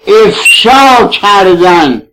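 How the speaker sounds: ordinary delivery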